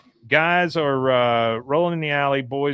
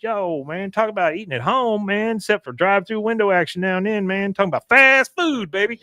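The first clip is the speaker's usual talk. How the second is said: Boomhauer impression; 8 semitones higher than usual. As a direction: ramble it fast and high. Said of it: "He goes".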